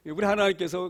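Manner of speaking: usual speaking voice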